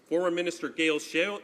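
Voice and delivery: ordinary talk, nothing marked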